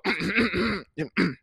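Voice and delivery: exaggerated repeated throat clear. Boyfriend readying himself to greet mama, showy throat clearing. Readies his deep voice.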